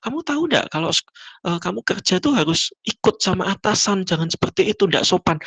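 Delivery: high-pitched indignant lecture; imitated angry boss. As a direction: scold like an offended superior. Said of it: "si ibu ini marah".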